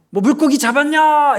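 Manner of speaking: high, loud shouted question; long-distance holler; calling out. Acted. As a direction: yell it across the water to a far boat. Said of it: "이렇게 소리치는 거죠".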